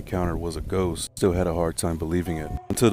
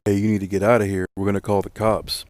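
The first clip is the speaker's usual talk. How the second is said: louder, urgent order to clear out; retold yelling; 3 semitones higher, 7 dB louder than usual. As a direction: imitate a foreman yelling a warning. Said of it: "was yelling at him".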